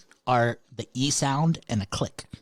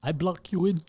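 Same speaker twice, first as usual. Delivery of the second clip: put-on higher-pitched teasing African accent; mock African accent; 5 semitones higher than usual. Teasing friend about his impression, mock African accent. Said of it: "how African people sound".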